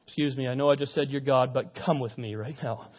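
low, softened aside; discreet rebuke. Acted. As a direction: take him aside quietly.

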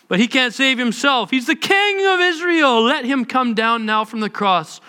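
high sneering taunt; mocking priests. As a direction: scorn him as you quote. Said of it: "the elders mocked him".

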